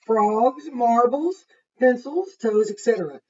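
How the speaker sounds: lively picture-book narration; reading to children. A narrator's reading. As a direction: read it to the class with energy. Reads the list of units.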